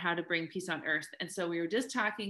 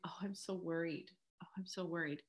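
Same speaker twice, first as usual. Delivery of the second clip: mock fretful whisper, much softer; worried whisper; 2 semitones lower, 11 dB quieter than usual. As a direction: sigh out the worry under your breath. Acting out someone stuck in worry.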